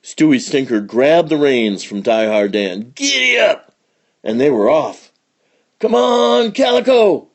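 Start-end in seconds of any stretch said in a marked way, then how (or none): 3.0-3.7 high call to the horses; driving holler. Acted. Outlaw grabs the reins and urges horses on, high holler. 5.8-7.3 calling out. Acted. Cowboy calls his horse during the stampede, high loud call. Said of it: "called Hank".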